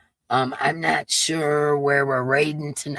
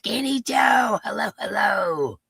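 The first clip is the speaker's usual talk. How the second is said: shouted greeting; 8 semitones higher than usual. Real, not acted. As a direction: call them out across the room. Greeting a chat member.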